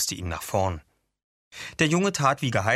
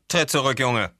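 put-on firm, raised-voice command to the boy; interrupting order; 4 semitones higher, 4 dB louder than usual. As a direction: break in and order him back. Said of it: "unterbrach Sonnenstich".

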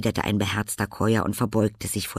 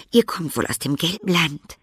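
put-on much higher, sweet girlish greeting; girl's voice; 8 semitones higher, 5 dB louder than usual. A narrator's reading. Girl greeting old Velina, polite.

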